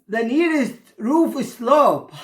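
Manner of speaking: usual speaking voice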